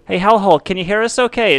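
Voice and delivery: loud, high call down into a pit. Pretend. Host calling down into the hellhole, loud high shout. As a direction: shout down the hole so they hear you.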